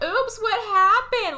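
slow, high singsong oops; mock innocence. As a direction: feign surprise, drawn out and high. Acts fake innocence pulling nightie down.

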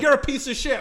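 high, loud mock insult; imitated cop's abuse; bad cop. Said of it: "They're trying to do bad cop".

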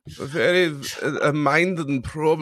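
put-on slow German-accented drawl; lightly hammed German accent; mock German accent. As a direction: stretch it out German style. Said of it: "Say it again for my pleasure".

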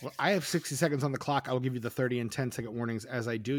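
ordinary talk

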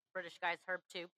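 usual speaking voice

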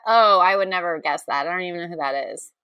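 ordinary talk

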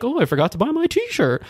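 ordinary delivery

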